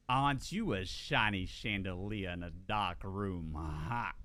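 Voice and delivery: slow, low Georgia drawl, lightly done; a hint of Georgia charm, slow and low; Georgia accent. Streamer doing a Georgia pickup line for chat, slow low drawl. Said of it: "I'm gonna do a Georgia voice".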